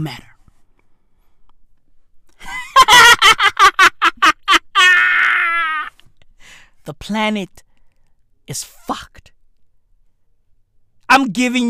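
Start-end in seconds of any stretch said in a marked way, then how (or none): 2.4-5.9 howling laugh, high and loud; big shrieking laugh; loud cry-laugh. Real, not acted. Crying laugh shout. 6.8-9.3 whispered doom; hushed grim whisper. Acted. Whispered punchline.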